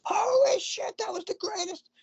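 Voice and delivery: high, far, excited announcer yell; screaming announcer. Retold or imitated. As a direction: scream it like a hype commentator. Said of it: "you know".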